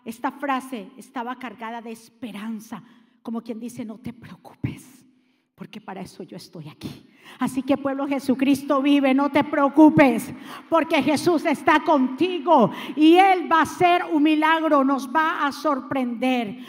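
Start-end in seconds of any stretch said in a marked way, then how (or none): 3.8-7.1 gentle whispered reassurance; soft reassurance. Acted. Jesus's reassurance paraphrased. 7.4-16.6 rally the congregation, louder each phrase; fiery sermon crescendo; preaching at full force. Acted.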